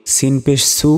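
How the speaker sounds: সু with a light, thin s and u vowel. Shown as a demonstration. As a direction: keep সু thin, as "su". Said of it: "দুইটার উচ্চারণ কিন্তু একই রকম হবে না".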